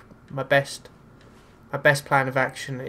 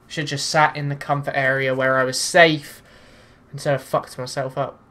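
ordinary talk, nothing marked

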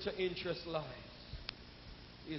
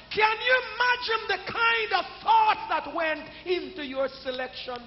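louder, higher preaching with rhythmic shouted lines; full-force preaching; 8 semitones higher, 12 dB louder than usual. Acted. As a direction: hammer each line out to the congregation.

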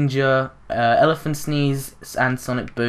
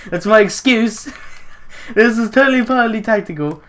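higher, louder mock-insistent through a laugh; laughing excuse; 7 semitones higher, 3 dB louder than usual. Gamer defending a hiding choice, laughing. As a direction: insist with a grin, half laughing.

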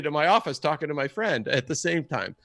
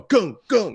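loud, high car-bump noises; imitated thuds; 7 semitones higher, 7 dB louder than usual. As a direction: punch out two bumps of a car hitting logs. Imitates car bouncing over logs.

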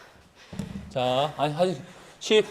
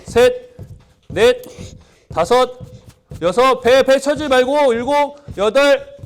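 loud, higher rep count; barking gym coach; 6 semitones higher, 4 dB louder, slower than usual. Real, not acted. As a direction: bark the count over the music.

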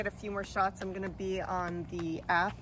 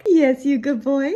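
much louder happy praise; loud dog praise; 4 semitones higher, 12 dB louder than usual. Real, not acted. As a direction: cheer the dog on.

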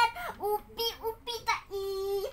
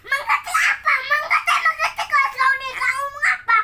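high, loud, laughing retort; loud laughing demand at the phone; laughing shout; 6 semitones higher, 9 dB louder than usual. Real, not acted. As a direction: yell back through laughter. Mocking the assistant, laughing.

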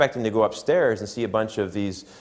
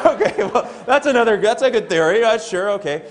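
high, louder words through a laugh; laughing reply; 10 semitones higher, 3 dB louder than usual. Retold or imitated. Coach amused by the beat-it-at-home plan, laughing. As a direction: chuckle your way through humoring him.

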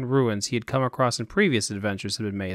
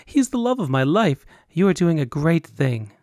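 higher, louder lovestruck gush; 5 semitones higher, 4 dB louder than usual. Acted. Woman gushing to Roland about her lover, higher and louder. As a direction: swoon over him out loud.

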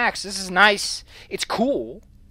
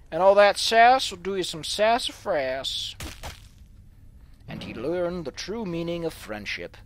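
ordinary talk

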